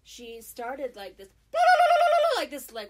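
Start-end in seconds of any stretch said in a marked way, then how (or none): 1.5-2.3 high ululating war cry. Retold or imitated. Neighbor lady raising the alarm, loud war cry. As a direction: imitate her shrill war cry. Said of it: "war cry thing".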